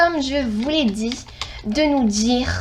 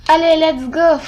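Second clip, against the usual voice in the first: pumped up; 4 semitones higher, 9 dB louder, slower than usual. Real, not acted. Starting the match.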